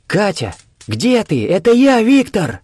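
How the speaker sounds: high, loud searching shout; calling out. A narrator's reading. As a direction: call out loudly to someone lost. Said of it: "и ещё раз позвал".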